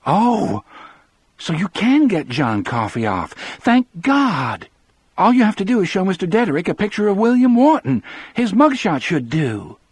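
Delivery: high, loud burst of relieved hope; excited relief. A narrator's reading. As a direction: rush it out, elated at the way out. Wife sees a way to free John.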